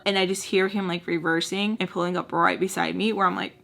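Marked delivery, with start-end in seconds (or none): none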